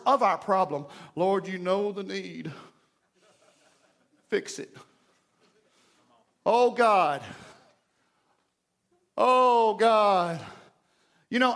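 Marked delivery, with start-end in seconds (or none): 1.2-4.8 mock a half-hearted mumbled-off prayer; lazy prayer. Preacher mimics people too lazy to voice their problem, lazy praying. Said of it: "we get so lazy in our prayer". 6.5-10.4 drawn-out mock moan to God; moaning prayer. Preacher mimics lazy prayer-meeting praying, moaning. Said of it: "just moan and groan and moan".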